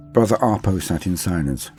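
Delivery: usual speaking voice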